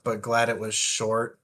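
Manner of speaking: ordinary talk, nothing marked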